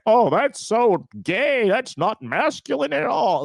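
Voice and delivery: high-pitched mocking whine; mock crybaby. Imitating dudes crying online about masculinity, mock crybaby whine. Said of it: "when I see dudes crying like that".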